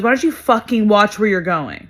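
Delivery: loud, imitated angry snap; angry confrontation. Grown man turning on a little girl at a concert, angry. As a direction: imitate an aggressive man telling someone off.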